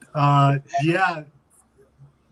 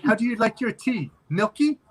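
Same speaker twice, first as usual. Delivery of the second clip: higher-pitched quoted skit line; lightly put-on, raised questioning voice; imitating Santino Marella; 7 semitones higher than usual. Co-host retells wrestling tea party skit, lightly imitating. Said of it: "And he's like".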